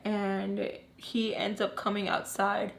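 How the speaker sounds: normal talking voice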